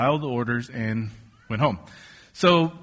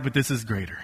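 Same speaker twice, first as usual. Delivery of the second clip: quiet inner voice; 5 semitones lower, 6 dB quieter, faster than usual. Retold or imitated. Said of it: "it's like".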